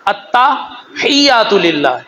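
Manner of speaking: التحیات للہ drawn out slowly with doubled t; correct Arabic recitation. Shown as a demonstration. Teaching the congregation the right Arabic, slow and precise. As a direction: spell out التحیات للہ slowly, stressing the tta. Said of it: "کیا ہے؟".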